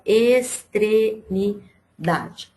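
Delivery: extremidade split slowly into syllables. Shown as a demonstration. Teacher asking pupils how many syllables it has, syllable by syllable. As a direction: say extremidade in separate syllables, pausing between them. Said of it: "Quantas sílabas tem a palavra".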